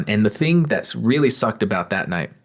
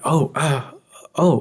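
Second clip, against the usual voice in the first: flustered customer; 7 semitones higher than usual. Retold or imitated. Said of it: "they would act like anime characters".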